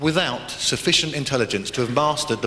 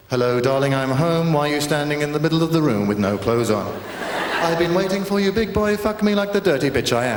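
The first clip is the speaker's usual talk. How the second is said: newsreader seduction parody; 2 semitones higher, 3 dB louder than usual. Retold or imitated. Comic mocking news voices, hammed-up newsreader reading smut. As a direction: deliver porn lines in a prim news voice. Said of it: "The way they talk on the news".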